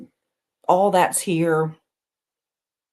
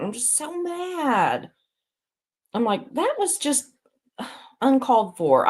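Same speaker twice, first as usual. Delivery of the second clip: real annoyance; 5 semitones higher than usual. Real, not acted.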